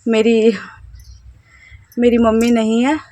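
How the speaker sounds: normal talking voice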